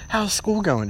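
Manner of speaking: mock mom's friend. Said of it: "And she's like".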